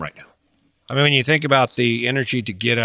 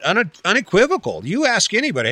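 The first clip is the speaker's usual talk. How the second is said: ordinary talk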